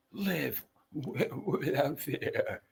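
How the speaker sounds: slow, quiet, wavering imitation; trembling voice. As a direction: try to make the voice tremble. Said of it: "his voice trembles all the time".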